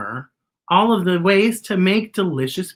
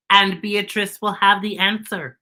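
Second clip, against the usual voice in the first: even, measured robot voice; monotone robot Z; 3 semitones higher than usual. Acted. Robot replying to the crow about the quest, in an even monotone. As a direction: keep every word on one note. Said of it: "explained Z".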